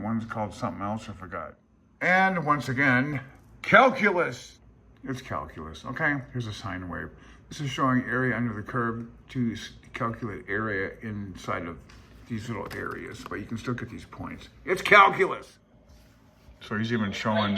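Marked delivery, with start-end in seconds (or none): 2.0-4.6 slow lead-in, then a loud, much higher "calculus"; mock game-show reveal; dramatic reveal. 14.6-15.6 loud, much higher mock announcement; hammed-up reveal; dramatic reveal. Running gag reveal.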